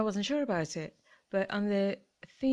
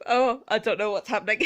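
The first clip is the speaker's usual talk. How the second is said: said through laughter, louder; laughing; 5 dB louder than usual. Real, not acted. Laughing at her chaotic shelf display to viewers. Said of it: "It's just chaos, isn't it".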